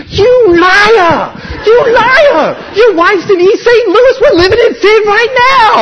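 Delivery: high, loud, near-tearful yelling of "liar"; furious accusing shout. Retold or imitated. Girlfriend jumps up and accuses Mike, furious, tearful shouting. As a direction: scream it at him, caught out and outraged.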